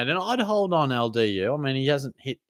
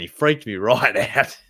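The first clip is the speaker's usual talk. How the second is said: ordinary talk, nothing marked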